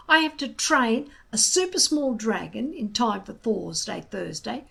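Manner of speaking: cross. A narrator's reading. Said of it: "said Hiccup crossly".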